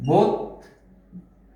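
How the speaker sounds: বত্রিশ drawn out as "bot-trish", with o and doubled t; o-sound pronunciation. Shown as a demonstration. Teacher showing learners the spoken form of বত্রিশ, bot-trish in separated syllables. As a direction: say বত্রিশ slowly in two parts, bot... trish. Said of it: "এই বকে ওকারান্ত করতে হবে".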